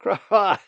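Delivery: loud high cawing laugh; sudden laugh. A narrator's reading. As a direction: burst out laughing, amused. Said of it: "Angie surprised him with a laugh".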